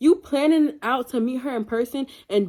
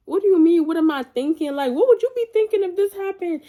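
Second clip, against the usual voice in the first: high-pitched, incredulous comeback; indignant, voice pitched right up; indignant retort; 8 semitones higher, 3 dB louder than usual. Retold or imitated. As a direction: throw the question back in disbelief. Acted retort in retold quarrel.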